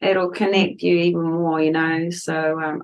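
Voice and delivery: normal talking voice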